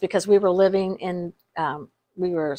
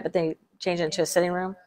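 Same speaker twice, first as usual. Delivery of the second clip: usual speaking voice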